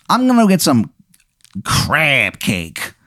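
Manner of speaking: put-on high, hammy Baltimore accent; terrible Baltimore accent. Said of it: "his terrible accent".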